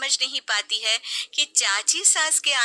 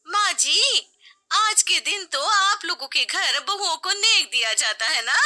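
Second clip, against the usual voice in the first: higher, sweet hinting; hint for a gift; sly young bride; 8 semitones higher than usual. Acted. Aunt's daughter-in-law hinting at a gift, sweetly.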